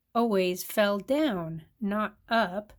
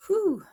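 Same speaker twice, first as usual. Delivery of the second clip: high loud breathless whew; gasped whew; 11 semitones higher, 6 dB louder than usual. A narrator's reading. Mole after crashing into pile, loud gasping whew. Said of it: "Myra gasped".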